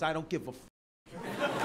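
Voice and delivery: slow, low, tired don't-care line; a hint of a jaded woman. Comic imitating a woman in the crowd, mildly slow and jaded. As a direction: drawl it like nothing matters. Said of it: "she wakes up every day and she goes".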